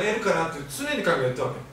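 ordinary talk

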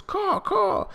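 high squawking caw caw; crow caws. Acted. Reader imitating the crows Caruso turned into, high caws.

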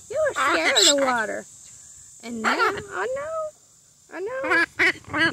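cooing to the bird. Real, not acted. Owner soothing her macaw after the bath, cooing.

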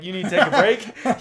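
laughing app voice. Pretend. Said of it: "It's gonna be like".

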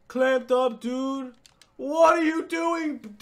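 normal talking voice

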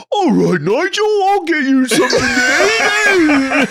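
high, loud, slow mock London accent; stupid London accent. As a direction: ham up a Cockney dad in the kitchen. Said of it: "do my stupid London accent".

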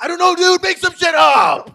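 high, loud exasperated yell; annoyed shout. Retold or imitated. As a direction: bark it at the actor. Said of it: "Dude looks at you and goes".

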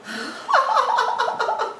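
long, high giggling laugh; sustained high cackle; amused laugh. Real, not acted. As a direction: crack up at the nickname. Laughing at the Google nickname.

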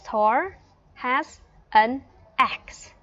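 slow, emphatic dictation-style English; teacher's model sentence. Shown as a demonstration. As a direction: model it for learners with gaps between words. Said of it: "thì cô sẽ nói là".

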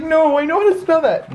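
high-pitched dog voice; playful high pet protest; voicing the dog. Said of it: "He's like".

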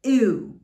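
loud, long falling "Ooh" as German ü; drawn-out front-rounded ü on "Ooh"; yucky-face ü. Shown as a demonstration. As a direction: say "Ooh" like a disgusted eww. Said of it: "exactly that way".

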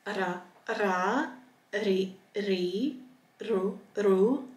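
rolled R in each of "Ra, ra, ri, ri"; "rai, rau, ram", each with a trilled R; hard trilled R. Shown as a demonstration. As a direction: drill "ra, ri, ru" with a vibrating R. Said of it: "So it's a hard R sound".

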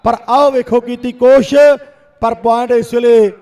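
rapid village kabaddi play-by-play; frantic rural sports commentary. Real, not acted. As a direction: call every raid live to the crowd. Second commentator calls raids. (ਤੇਜ਼ ਪੇਂਡੂ ਖੇਡ ਕੁਮੈਂਟਰੀ)

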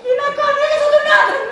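high-pitched plaintive grief; tearful lament. Acted. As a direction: lament as a new widow. New widow laments. (κλαψιάρικα, ψηλή φωνή θρήνου)

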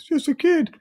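very high squeaky mimicry; mock him in a silly falsetto; bully parroting his friend in a high falsetto. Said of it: "in a falsetto voice".

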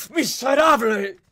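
high, loud burst of hatred; frenzied, drawn-out shout; hateful scream. A narrator's reading. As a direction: scream the insult in a fit of rage. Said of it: "gritó de pronto en un a-, acceso de odio".

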